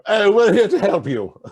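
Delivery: loud, high, jokey voice for the English; mock English ally. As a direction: ham up the cheery English ally, laughing. Acting out the English allies.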